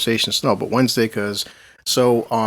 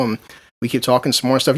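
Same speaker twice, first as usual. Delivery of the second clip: ordinary talk, nothing marked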